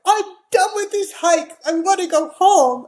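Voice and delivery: put-on a rattled boy who wants to quit; upset son. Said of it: "He's like".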